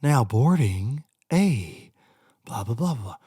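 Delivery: slow, droning boarding call; mock the airline announcer, drawn out; a lightly voiced gate agent reading the boarding call; gate agent announcement. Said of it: "And they're like".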